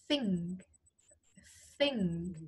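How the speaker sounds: "Think" with the th blown outward; "Think", voiceless th through the teeth; airy th. Shown as a demonstration. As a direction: push a stream of air out at the start of "Think". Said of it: "âm gió đẩy ra ngoài".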